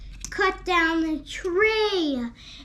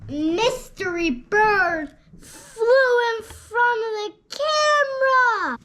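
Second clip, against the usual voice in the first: dramatic high narration; 7 semitones higher, 4 dB louder than usual. A narrator's reading. Child narrating dramatic moment.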